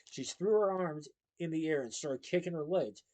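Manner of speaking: ordinary delivery, nothing marked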